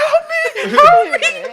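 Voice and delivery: high mock scream, rising in volume; panicked cry for help. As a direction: imitate a locked-in friend begging for rescue. Retells Devin locked in with dog.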